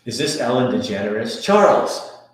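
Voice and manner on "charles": excited call. Real, not acted. Excited greeting of a chatter.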